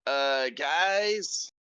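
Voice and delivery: weary, drowsy call to the group; tired voice. Acted. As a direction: say it like you're barely awake. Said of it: "kind of in a tired voice still".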